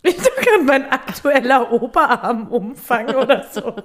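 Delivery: laughing through the words; giggling while talking. Real, not acted. Laughs at misheard word.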